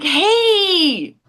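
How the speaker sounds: high, bright put-on "Hey!"; forced party entrance. Making her big entrance at the lawn party, high put-on cheer. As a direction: burst in with a fake-happy greeting. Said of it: "I just have to come in like".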